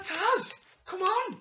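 very high, pleading reproach; exasperated protest. Acted. Host telling off the absent commentator, exasperated. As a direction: scold him in mock disbelief, voice pitched right up.

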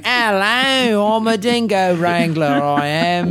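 hammed-up bad Australian accent, pitched high; a deliberately awful Aussie accent; host opens the show in a mock Australian accent; horrible Australian accent. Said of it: "With that accent".

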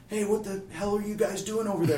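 high-pitched retold call across the table; imitating a nosy, incredulous shout. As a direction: holler over at the group, half in earnest. Retold man calling across the table.

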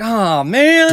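high, loud pouting whine; mock disappointed whine. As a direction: whine like a let-down kid. Imagined disappointed killer.

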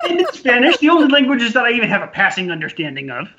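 high, loud laughing voice easing down; laughing protest. Real, not acted. Co-host teased about pronunciation, protesting through laughter. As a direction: protest through real laughter, pitch shooting up.

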